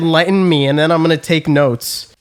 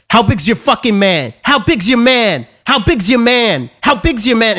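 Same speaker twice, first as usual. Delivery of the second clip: imitating an aggressive guy, insistent, higher-pitched repeats; aggressive stranger; 4 semitones higher than usual. Cokehead accosting a guy on his phone, mock aggressive. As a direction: badger them with the same demand, acted out. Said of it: "like, so fucking aggressive".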